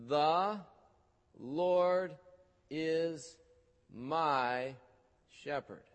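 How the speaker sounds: "The Lord is my shepherd" with a pause after every word; number the words one by one slowly; counting out each word. Shown as a demonstration. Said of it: "I want you to number the words".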